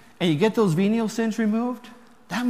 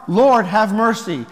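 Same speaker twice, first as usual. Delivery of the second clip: loud, slow, heartfelt plea; from the heart; 2 semitones higher, 8 dB louder, slower than usual. Shown as a demonstration. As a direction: plead it earnestly, with full weight. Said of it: "you say from your heart".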